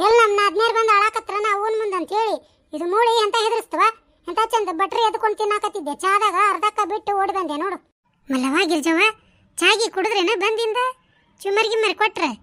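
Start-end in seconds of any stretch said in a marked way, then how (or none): none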